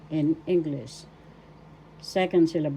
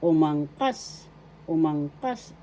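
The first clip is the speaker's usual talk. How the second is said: umangkas with the final "kas" stressed; stress on third syllable; slower than usual. Shown as a demonstration. Teacher showing how you pronounce it, accent on final "kas" of umangkas. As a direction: stretch umangkas out and land on "kas". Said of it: "The accent is in the third syllable".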